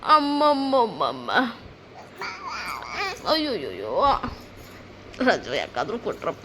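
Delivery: low, slow, drawn-out lament; weary exasperation. Real, not acted. Parent lamenting the children's holiday, low weary exasperated groan. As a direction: groan it softly, sinking low.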